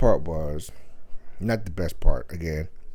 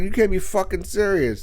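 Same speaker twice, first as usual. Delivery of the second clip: pitched up, stunned outrage; mock outraged disbelief; 8 semitones higher than usual. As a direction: throw it back in disbelief. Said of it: "I was like".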